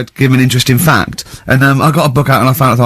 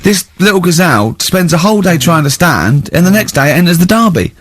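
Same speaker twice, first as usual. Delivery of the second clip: incredulous enthusing; 7 semitones higher than usual. Real, not acted. Host insisting to Carl the fact is amazing, excited. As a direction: sell the wonder of it, pitch raised. Ricky marvelling at gazelle fact.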